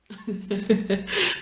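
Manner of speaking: short chuckle in quick pulses; laugh. Real, not acted. Vlogger breaking her own silence before talking about earrings, laughing. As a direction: let out a little rippling laugh.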